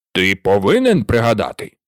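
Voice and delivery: low, pressing command; the king insisting firmly; emphasised. A narrator's reading. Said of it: "підкреслив король".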